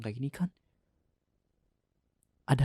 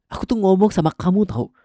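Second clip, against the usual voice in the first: angry shout; 8 semitones higher, 8 dB louder than usual. Acted. Husband yells at wife.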